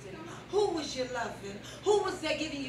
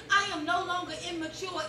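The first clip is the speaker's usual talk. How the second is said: usual speaking voice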